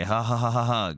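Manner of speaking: flat, deliberate ha-ha-ha, unamused; mock laugh. Streamer mocks the troll's clown joke with a flat fake laugh. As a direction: spell out a fake laugh with a Russian accent.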